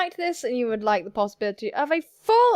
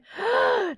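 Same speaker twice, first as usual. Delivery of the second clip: high, sharp intake of breath; hammed-up shocked gasp; theatrical gasp; 12 semitones higher than usual. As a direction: gasp in sudden realization. Acted gasp of realization.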